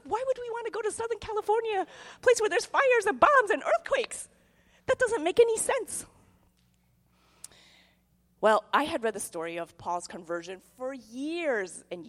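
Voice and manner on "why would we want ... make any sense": high, indignant disbelief; mock-outraged whining at the idea of moving; incredulous complaint. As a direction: protest like it's obviously absurd. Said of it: "I was like".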